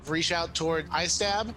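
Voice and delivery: ordinary delivery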